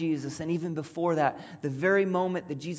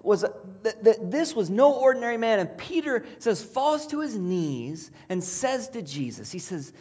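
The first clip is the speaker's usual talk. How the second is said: ordinary delivery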